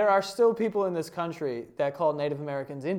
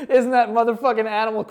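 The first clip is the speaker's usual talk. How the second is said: ordinary talk, nothing marked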